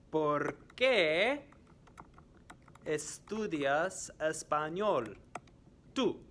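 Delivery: "¿Por qué" high and loud, "estudias español" slow, "tú" apart; slow and split up for learners; slow quiz question. Shown as a demonstration. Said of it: "I am going to ask some questions".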